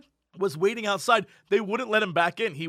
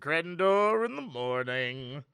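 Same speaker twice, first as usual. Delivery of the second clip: slow welcome; 3 semitones lower, slower than usual. Acted. Show greeting.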